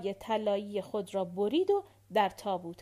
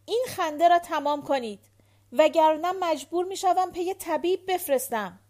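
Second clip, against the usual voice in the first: higher, louder order to stop the laughing; stern command; 6 semitones higher, 7 dB louder than usual. A narrator's reading. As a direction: snap her out of the fit, firmly and loud. Julie orders hysterical Hortense to stop.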